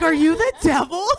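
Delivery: high, loud horrified question; mock scared. Mother confronting scary daughter, mock fright. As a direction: ask in hammed-up alarm. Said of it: "I was so scared of her".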